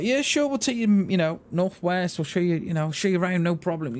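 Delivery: high, breezy reassurance; 1 cheerful offer. Retold or imitated. Reassuring the nervous newcomer, high cheerful offer. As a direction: lift the voice up high and keep it easy.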